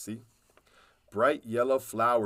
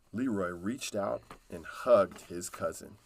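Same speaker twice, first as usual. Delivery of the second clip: ordinary delivery, nothing marked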